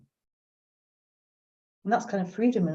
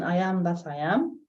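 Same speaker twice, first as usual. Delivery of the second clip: rising question tune on the final am; read as a question. Shown as a demonstration. Reading the viewer's chat line aloud as a question, rising. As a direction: lift the last am up like a real question. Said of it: "If it is a question".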